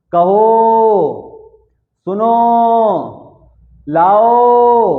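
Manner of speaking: loud call with each final ओ stretched long; prolonged calling. Shown as a demonstration. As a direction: call out कहो, सुनो, लाओ, holding the last vowel. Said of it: "इसमें ज्यादा समय लग रहा है".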